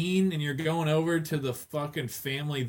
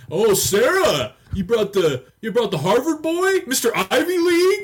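loud, much higher teasing voice; mocking cousin; 10 semitones higher, 8 dB louder than usual. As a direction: rib the new boyfriend in a hammed-up sing-song. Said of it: "they start".

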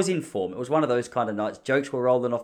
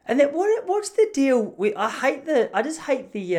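much higher, rising exasperated rant; real aggression; 11 semitones higher than usual. Real, not acted. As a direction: go off in a high, worked-up tirade. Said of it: "coming at you with a little bit of aggression".

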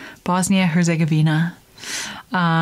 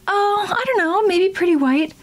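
much higher, airy unsure voice; ditzy ad actor; 12 semitones higher than usual. Retold or imitated. Unsure customer in a toothpaste ad, high mock voice. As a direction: play the clueless customer in an ad. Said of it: "stupid commercials".

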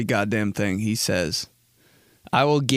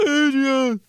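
high, wailing mock cry; hammed-up tearful call of a name; crying Rocky; 12 semitones higher than usual. Comedian imitates himself crying like Rocky, high mock wail. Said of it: "I sounded like Rocky too".

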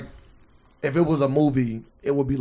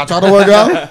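raised, laughing mock complaint; quoting Patrice; 4 semitones higher, 8 dB louder than usual. Friend retells Patrice's diet joke, laughing. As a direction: laugh through a pitched-up whine. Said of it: "He said".

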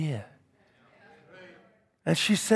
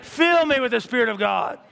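Caleb crying out high and loud for his mountain; a man demanding his promise from God, shouted and eager; eager shouted prayer; 8 semitones higher, 3 dB louder than usual. Retold or imitated. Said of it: "Caleb's like".

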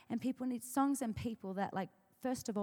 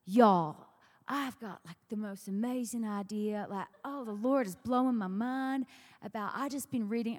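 put-on Louisiana accent; 6 dB louder than usual. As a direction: imitate a Southern songwriter bursting with an idea. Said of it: "in her beautiful Louisiana accent".

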